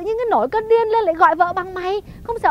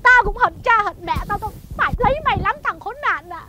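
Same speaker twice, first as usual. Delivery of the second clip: ordinary talk, nothing marked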